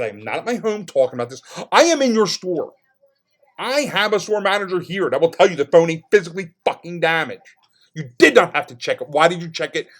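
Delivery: loud, high, furious tirade; retold screaming rant. Customer berating phone company from the store, yelling angrily. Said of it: "that's when I started screaming in the store".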